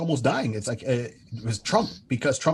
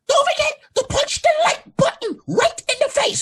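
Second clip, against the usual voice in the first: very high, loud hyper shout; screeching YouTuber; 7 dB louder than usual. Retold or imitated. Mocking a streamer's sign-off, high screeching yell. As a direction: yell it like an over-the-top streamer. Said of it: "almost screeching".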